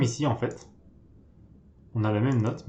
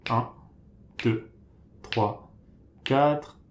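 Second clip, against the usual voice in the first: counting beats; much slower than usual. Shown as a demonstration. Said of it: "on va attendre quatre temps".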